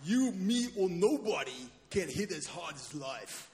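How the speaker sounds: hammed-up movie boxer; Rocky. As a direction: start big, then settle into the boxer impression. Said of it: "that's my Rocky impression".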